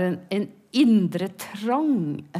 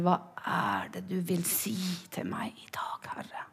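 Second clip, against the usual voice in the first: softly whispered prayer; 3 semitones lower, 11 dB quieter than usual. Acted. Asking the Lord what he says today, whispered. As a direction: pray it under your breath. Praying to God quietly.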